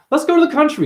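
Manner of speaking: high, loud imitation of eager trippers; mock enthusiasm. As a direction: chirp it in an exaggerated high voice. Mocks people planning country trips.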